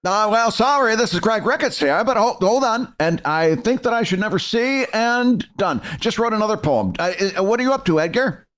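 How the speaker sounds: a hint of a proper Englishman; mock British poet voice; vaguely British. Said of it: "a vaguely British version".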